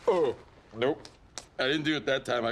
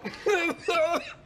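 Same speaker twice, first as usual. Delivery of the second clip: giddy laugh. Acted. Laugh after snorting powder.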